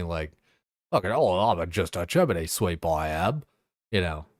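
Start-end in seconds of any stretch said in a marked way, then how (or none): 0.9-3.4 mock Cockney accent with swooping pitch. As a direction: ham up a Cockney chimney sweep.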